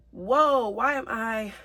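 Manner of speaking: high, loud burst of surprise; startled whoa. Real, not acted. Reader is suddenly surprised at tearing up mid-reading. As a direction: caught off guard by her feelings. Startled by her own emotion.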